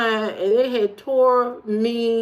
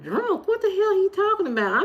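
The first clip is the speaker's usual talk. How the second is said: high indignant; 9 semitones higher, 4 dB quieter than usual. Retold or imitated. Retold indignant reaction.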